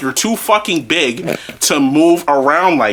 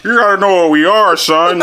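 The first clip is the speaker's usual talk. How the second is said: mocking dad impression; 3 semitones higher than usual. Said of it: "he's just like".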